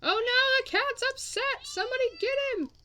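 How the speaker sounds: very high, loud alarmed cry; shrill-pitched oh no alarm; panicked squeal. Real, not acted. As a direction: squeal in mock panic for the cat. Cat upset, panicked outcry.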